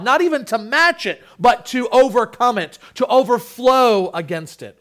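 loud, high impassioned preaching; preaching at full force. Acted. Pastor to congregation, loud soaring preaching. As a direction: lift the room, proclaim grace soaring.

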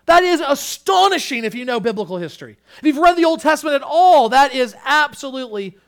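high, loud, emphatic proclamation; full-force preaching. Real, not acted. Preacher assuring church of the king's reward, full-force preaching.

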